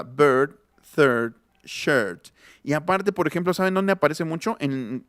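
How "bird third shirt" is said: bird, third, shirt with the vowel like a tighter E plus r; more closed E. Shown as a demonstration. Teacher explaining it is not so difficult, closed-vowel demo. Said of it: "pero más cerrada".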